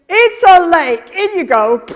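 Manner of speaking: loud, high mock command; brusque wrong way. Mother shoving toddler towards the lake, loud and brusque. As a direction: push them in with a big blunt shout. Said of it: "you don't say".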